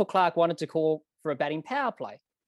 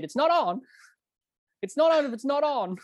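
high-pitched imitated umpire's refusal; mock insistent; 10 semitones higher, 3 dB louder than usual. Umpire refusing over the stump mics, mock insistence. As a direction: insist in a raised, play-acted voice.

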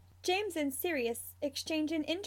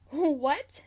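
louder, incredulous question; amused disbelief; 3 semitones higher, 7 dB louder than usual. A narrator's reading. Marlene hears the cub's nickname, amused disbelief. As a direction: ask it in amused bafflement. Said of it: "Marlene laughs".

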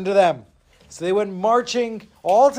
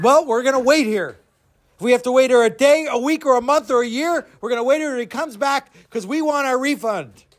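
stubborn crowd; 7 semitones higher than usual. Acted. Crowd vows to wait.

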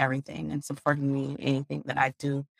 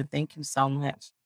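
ordinary talk

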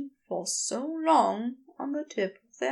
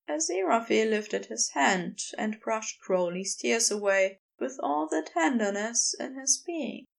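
plain reading voice

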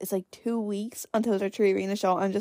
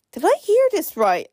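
much higher, louder shocked question; high-pitched disbelief; 9 semitones higher, 4 dB louder than usual. Retold or imitated. Hearing mum got a puppy, high-pitched disbelief. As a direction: pitch it way up like you can't believe it. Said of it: "I talked such high pitched there".

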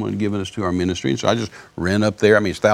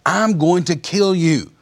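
slow, raised, deliberate mock yell; retold scream; 7 semitones higher, much slower than usual. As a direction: imitate an attacker's threat, high and drawn out. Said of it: "he screamed at me and said".

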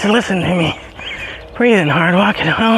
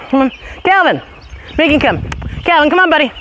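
high dog calls; 10 semitones higher, faster than usual. Real, not acted. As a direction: call each dog in. Calling dogs.

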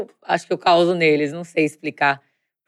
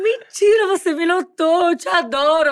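much higher, sweet teasing voice; playful gushing reply; 11 semitones higher than usual. Retold or imitated. Singer teasing a hater on Instagram, high and sweet. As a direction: coo it back like a delighted fan. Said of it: "Aí eu falei".

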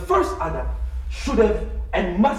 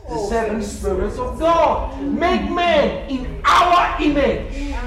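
loud, fervent sermon delivery; preaching; 5 semitones higher, 5 dB louder than usual. Acted. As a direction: preach it with rising fire. Preaching at full force.

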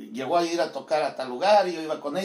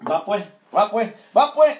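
louder, higher mock Guatemalan "va, pues"; hammed-up Guatemalan accent, loud and high; 4 semitones higher, 7 dB louder than usual. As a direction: echo it like a Guatemalan, hamming it up. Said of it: "¿cómo hablan en tu rancho?".

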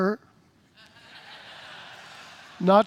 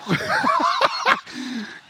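laughing. Real, not acted. Laughs after impression.

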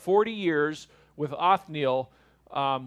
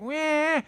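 high-pitched mock baby cry; imitated whiny baby wail; 11 semitones higher than usual. Baby fighting sleep, mock wailing. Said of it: "And they're like".

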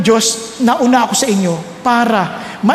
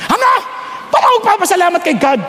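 mock-indignant, quick and higher protest; angry retort; 6 semitones higher, much faster than usual. Angry woman refusing to thank God, quick higher outburst. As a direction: toss it back fast like an offended woman. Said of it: "Galit na galit".

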